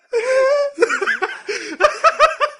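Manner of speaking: high, loud, teary wheezing laugh; cracking up at the fake-couple mix-up; helpless laugh. Real, not acted. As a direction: lose it completely laughing. Helpless laughter mid-story.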